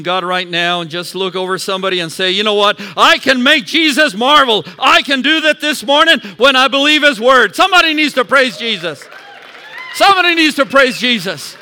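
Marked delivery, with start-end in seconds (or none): 2.3-11.6 loud, high, driving preacher's call; preaching at full force. Acted. Pastor urging the congregation to praise, shouting. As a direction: stir the church up to praise.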